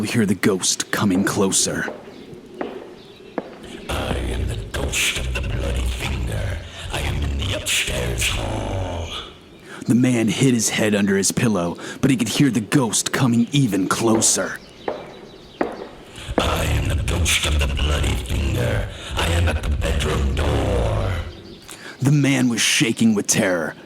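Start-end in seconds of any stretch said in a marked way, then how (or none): 3.9-9.1 ghostly moan. A narrator's reading. Ghost approaching, repeated chant. 16.4-21.1 ghostly moan. A narrator's reading. Ghost at the door.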